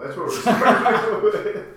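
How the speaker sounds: slow, laughed-out words; laughing. Real, not acted. Laughing at own joke.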